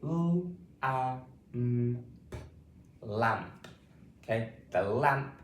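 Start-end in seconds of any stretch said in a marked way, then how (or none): 0.0-2.4 L, A, M, P said sound by sound; phonics-style sounding out of L, A, M, P. Shown as a demonstration. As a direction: separate each sound of the word with small gaps. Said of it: "saying these sounds and words".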